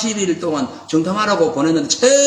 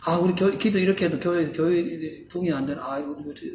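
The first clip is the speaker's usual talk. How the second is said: softer and fading worried muttering; fretful sigh; 3 semitones lower, 9 dB quieter than usual. Retold or imitated. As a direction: grumble to yourself in doubt. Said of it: "이런 얘기 한 적이 있어요".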